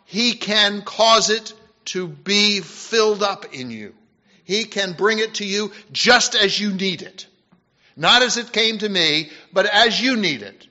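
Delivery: loud, ringing pulpit voice building to a climax; full-force preaching. Acted. Preacher exhorting the congregation, at full force.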